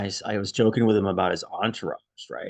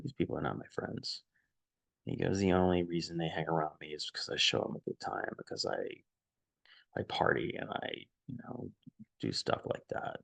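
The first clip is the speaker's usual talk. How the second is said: low, near-whispered confession; hushed confiding; 3 semitones lower, 8 dB quieter than usual. Retold or imitated. Friend confiding to the narrator on the couch, hushed whisper. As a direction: drop to a breathy hush as if sharing a secret.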